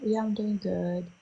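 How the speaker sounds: mock awkward, keeping it small and quiet; awkward reply. Woman answering the man she ghosted, softly awkward. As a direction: softly imitate an uneasy, polite answer. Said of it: "it was so awkward".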